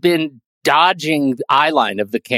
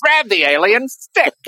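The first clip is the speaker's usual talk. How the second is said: slow, lightly voiced newsreel announcer; hint of an old-timey radio announcer; 7 semitones higher than usual. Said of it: "news, uh, newsreel voice".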